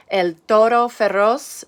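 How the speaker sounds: "El toro feroz" with Spanish vowels, rolled r; Spanish phrase. Shown as a demonstration. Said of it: "means the fierce bull".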